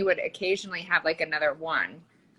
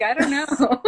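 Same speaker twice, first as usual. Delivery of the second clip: innocent husband; 7 semitones higher, 6 dB louder than usual. Retold or imitated. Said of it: "totally in his innocence".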